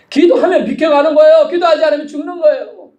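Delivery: high, loud pulpit cry; forceful warning. Real, not acted. Preacher warning believers to pray or perish, forceful warning. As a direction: drive the warning home at high pitch.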